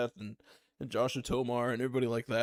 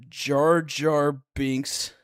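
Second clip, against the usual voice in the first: stretched out very slowly, louder; dramatic pause; 5 dB louder, much slower than usual. Real, not acted. Telling the D and D group story, slow dramatic reveal. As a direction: draw it out for the reveal.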